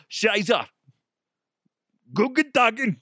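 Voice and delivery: high-pitched cartoon German accent; hammed-up German accent; bad German accent. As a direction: call it out in mock German. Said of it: "don't even know what a German accent sounds like".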